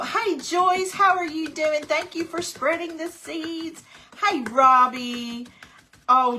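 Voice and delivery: high, loud hello to a friend; light up and call out her name; happy greeting. Real, not acted. Host greeting viewer Joyce in the chat, high and loud.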